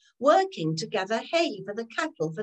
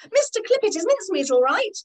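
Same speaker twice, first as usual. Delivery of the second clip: worried calling; 9 semitones higher, 5 dB louder, faster than usual. A narrator's reading. Worried grandma calls out.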